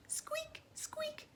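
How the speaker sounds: very high tiny squeaks; squeaky mouse. Acted. Imitating the mice.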